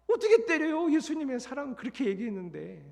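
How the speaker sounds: exasperated outcry. Retold or imitated. Torn about hitting soldiers.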